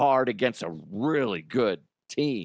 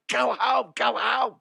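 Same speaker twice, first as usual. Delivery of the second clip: coach shouting; 12 semitones higher than usual. Retold or imitated. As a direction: scream him home from third. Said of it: "And then he's like".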